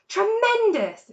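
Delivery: high loud slow exclamation; shouted. A narrator's reading. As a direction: shout it with joy. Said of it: "shouted Badger".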